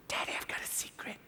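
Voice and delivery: soft unvoiced child whisper. Son whispering in his dad's ear, a soft whisper. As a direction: lean in and breathe the secret. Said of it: "he whispers in my ear".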